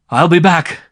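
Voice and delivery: loud, high call. A narrator's reading. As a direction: call back across the distance. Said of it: "he called to Light".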